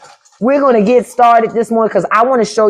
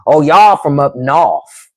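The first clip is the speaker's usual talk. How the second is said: slow mock Southern drawl; imitating Southern family, stretched out; Southern relatives teasing; 3 semitones lower, slower than usual. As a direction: draw it out like teasing Southern kin. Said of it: "my family will say quick".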